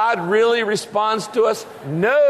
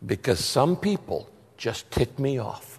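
low, quiet God voice, dry; a hint of a grumpy God; 7 semitones lower, 5 dB quieter than usual. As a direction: deliver it as a deadpan God from above. Joke punchline, God's voice.

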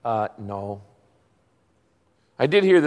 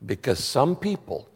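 voice from heaven; 6 semitones lower, 6 dB quieter than usual. Retold or imitated. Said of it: "this voice from heaven that says".

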